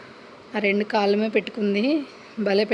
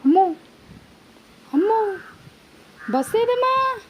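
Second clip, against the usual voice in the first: much higher cooing with a gasp; 10 semitones higher than usual. Real, not acted. Cooing to dog.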